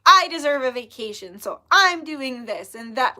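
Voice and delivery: loud, self-righteous mock demand; rude attitude. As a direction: act out entitled indignation. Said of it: "like, a rude attitude".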